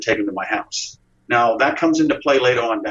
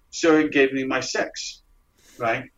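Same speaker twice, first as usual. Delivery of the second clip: ordinary talk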